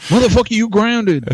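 a hammed-up parental telling-off; mock scolding dad. Dad jokingly grounding his kid over rival team gear, mock outrage.

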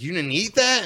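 high, loud incredulous question; mock disbelief. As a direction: ask it as if truly scandalized. Incredulous at wasted drug residue.

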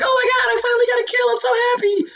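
put-on very high-pitched, delighted little-girl voice; squeaky-high mock girlish excitement. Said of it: "it was like this little girl hopped in the chat".

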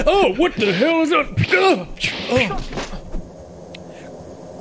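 high, loud mock shriek fading into a groan; hammed-up shock as the attack lands; startled scream. Ambush victim reacting to the attack, startled scream.

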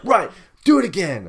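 high, loud curt order; mock officer command. Retelling the officer ordering him to redo the prints, high mock bark. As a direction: bark it like a bored border guard.

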